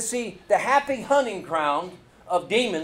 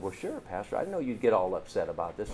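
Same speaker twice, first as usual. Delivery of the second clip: softer, lower voice for the mother; quiet retelling; 6 semitones lower, 8 dB quieter than usual. Retold or imitated. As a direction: hush it down. Mother's reply retold softly.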